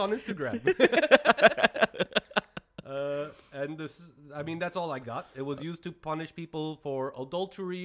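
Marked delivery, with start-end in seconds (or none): 0.6-2.8 loud laugh. Real, not acted. Big laugh.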